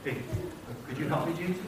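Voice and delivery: soft, low timid ask; meek close-up request. Acted. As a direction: ask quietly, as if standing right beside him. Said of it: "they can't come in and say".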